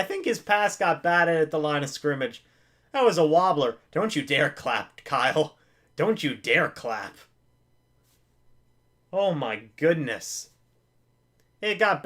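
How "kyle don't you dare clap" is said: ordinary delivery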